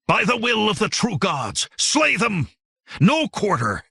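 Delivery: high, ringing war cry; rally the riders to slaughter; fanatic's high battle shout. A narrator's reading. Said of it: "Jinar's cry rent the darkness".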